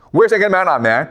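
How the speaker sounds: ordinary talk, nothing marked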